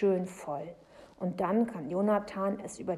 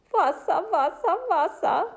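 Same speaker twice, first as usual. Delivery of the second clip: ordinary delivery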